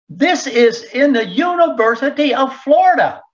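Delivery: incredulous. Real, not acted. Disbelief at the university letter.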